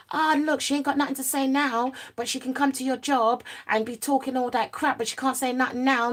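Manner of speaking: high, taunting voice of the instigating friends; mocking instigators. Her friends egging her on.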